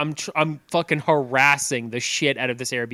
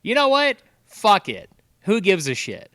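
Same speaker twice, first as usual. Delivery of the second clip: higher, louder let-it-go shout; defiant outburst; 7 semitones higher, 5 dB louder than usual. Retold or imitated. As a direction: fling it out the window in defiance. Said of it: "I was like".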